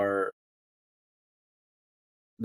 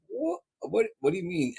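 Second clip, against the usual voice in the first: mock puzzled; 9 semitones higher than usual. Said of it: "you're like".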